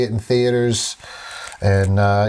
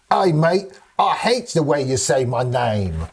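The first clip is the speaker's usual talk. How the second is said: mock Australian, drawn-out vowels; imitating an offended Australian; Australian accent; slower than usual. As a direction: put on a hammed-up Aussie accent. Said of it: "when they hear that accent".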